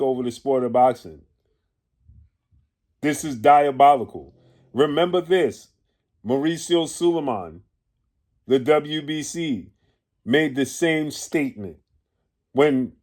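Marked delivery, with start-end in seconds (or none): none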